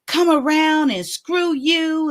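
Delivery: high, drawn-out mock scolding; fussing. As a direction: stretch out the imitated put-down. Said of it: "she started fussing at me".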